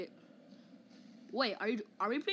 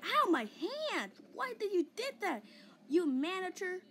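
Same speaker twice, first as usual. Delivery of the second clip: high-pitched pained outcry, play-acted; hurt protest; 7 semitones higher than usual. Player scolding whoever hurt the hand, high mock-hurt protest. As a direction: yelp and complain in a high voice.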